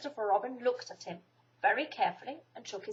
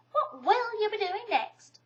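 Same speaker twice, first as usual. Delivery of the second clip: Kanga's voice; 11 semitones higher, slower than usual. A narrator's reading. Kanga voiced in read story.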